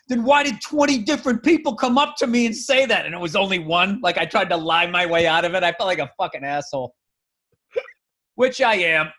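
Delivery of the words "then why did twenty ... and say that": defensive comeback. Retold or imitated. Him lying defensively to hosts.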